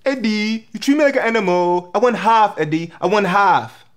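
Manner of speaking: high-pitched complaining wife character; demanding wife. Acted. Comic playing a wife wanting half, demanding.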